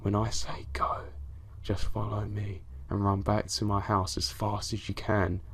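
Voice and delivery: urgent secret plan in a whisper; hushed, breathy whisper to friends huddled close. A narrator's reading. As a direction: whisper it so only your friends hear. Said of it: "My classmate whispered".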